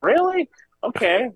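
very high mock disbelief; mock the adults' stunned reaction; surprised adults. Adults react to how young he was, very high mock surprise. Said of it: "they were like".

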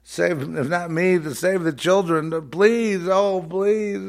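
high, wailing plea; mock tearful begging. Imitating a beggar pleading for the children, mock crying. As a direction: beg in a hammed-up cry. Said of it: "they're begging and begging and they're crying".

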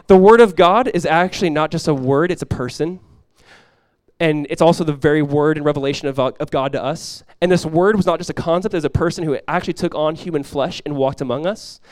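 ordinary delivery

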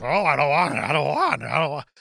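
mock a reluctant bad guy, words slurred together; whining Sam. Recapping the villain being force-fed beans, mock reluctant protest. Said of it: "Sam's like".

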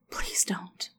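soft whisper into his ear; woman pleading in his ear, whispering. A narrator's reading. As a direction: beg him in a whisper. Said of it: "she pleaded into his ear softly".

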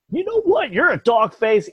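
high, loud mocking old-man jab; hammed-up old man voice; mock Biden insult. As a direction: sneer it like a cranky old man. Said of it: "this old man".

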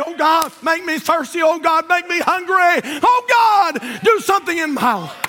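high, drawn-out pleading shout; impassioned cry to God. Acted. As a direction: cry out to heaven, building to a shout. Crying out to God in prayer.

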